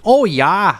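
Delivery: high, loud mock Wisconsin; hammed-up Midwestern Wisconsin lilt; Wisconsin accent. Imitating someone from Wisconsin, broad accent. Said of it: "you'll hear somebody talk from Wisconsin".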